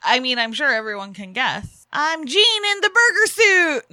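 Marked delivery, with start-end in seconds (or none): 1.9-3.9 high, loud joke character announcement; Jean impression. Joking to partner about the costume, loud mock Jean. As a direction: belt it out as a mock introduction. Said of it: "No, I'm just kidding".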